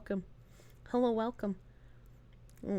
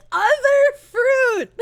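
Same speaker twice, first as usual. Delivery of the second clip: high, slow, loud exclamation; mock outcry; 11 semitones higher, 5 dB louder, much slower than usual. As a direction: shout it high and stretched out. Mock cry echoing own phrase.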